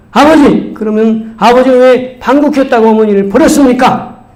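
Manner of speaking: retold son's indignant outburst; shouted accusation. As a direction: confront a father in front of everyone. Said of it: "소리쳤어요".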